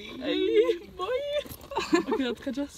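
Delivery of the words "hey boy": high squeal. Pretend. Echoed greeting in cave.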